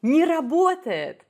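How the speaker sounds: Сломалась, а? Не работает said high and loud, stress on не; Harbour's Russian line. Retold or imitated. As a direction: imitate the lines in Harbour's voice, lifted. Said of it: "For an American speaking Russian".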